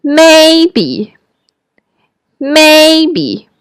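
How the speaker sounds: maybe pitched high in the range; high reluctant maybe to a friend. Shown as a demonstration. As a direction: lift maybe up to your top note. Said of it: "Please say it a few times".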